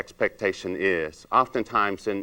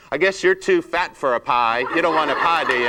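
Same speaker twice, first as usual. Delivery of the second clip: higher, sneering put-down; snide insult; 4 semitones higher, 3 dB louder than usual. Retold or imitated. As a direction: talk down to the customer with mock contempt. Imagined rude speaker insulting customer.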